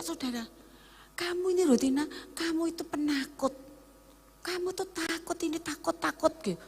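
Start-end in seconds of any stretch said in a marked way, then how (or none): 1.2-6.3 softly and simply, no accusation; simple gentle tone. Retold or imitated. Servant of God gently telling Rutina she is fearful. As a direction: say it calmly, like a plain observation. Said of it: "omongnya cuma dengan sederhana loh".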